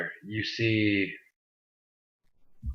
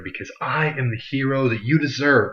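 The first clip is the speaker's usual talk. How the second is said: a hint of a grand hero line, rising much higher at the end; mock movie hero; 4 semitones higher than usual. Vlogger signing off to viewers with a lightly hammed-up hero line. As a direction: lightly ham up the famous hero quote. Sign-off parodying the Batman line.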